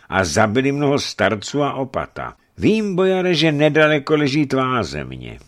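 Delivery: high, loud, indignant demand; commanding bishop. Acted. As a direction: denounce the crime and order punishment.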